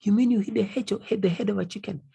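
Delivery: high, astonished disbelief; shocked question. Acted. Traveller seeing Nasra eat chicken head, astonished and high. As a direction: sound amazed at what she eats. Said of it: "You tell her".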